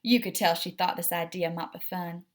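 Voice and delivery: usual speaking voice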